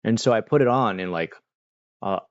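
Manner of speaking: ordinary delivery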